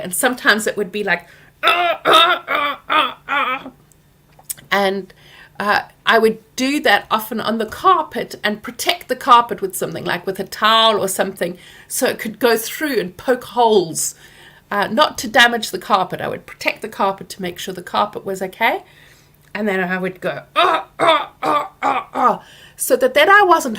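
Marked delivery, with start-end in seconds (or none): 1.6-3.7 high, loud rhythmic grunting; anger grunts. Retold or imitated. Letting anger out in drawing. 20.5-22.4 high, loud rhythmic grunting; anger grunts. Retold or imitated.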